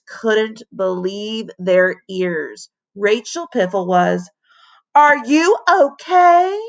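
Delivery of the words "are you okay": high, loud, slow worried call; worried cry. A narrator's reading. Classmate crying out to Rachel, alarmed. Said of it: "cried Amisha".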